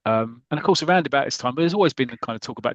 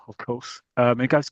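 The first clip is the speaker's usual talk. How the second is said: ordinary delivery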